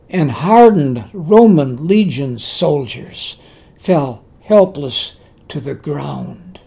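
ordinary delivery

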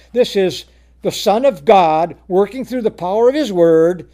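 usual speaking voice

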